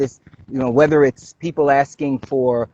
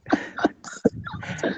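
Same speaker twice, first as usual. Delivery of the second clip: high giggle; 7 dB quieter than usual. Real, not acted. Laughing at Frankie joke.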